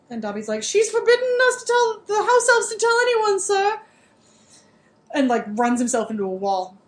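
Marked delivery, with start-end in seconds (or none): 0.6-3.8 much higher, fretful house-elf; anxious elf pleading with Harry, much higher. Acted. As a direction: squeak it out like a panicked Dobby. Dobby voiced in retold scene.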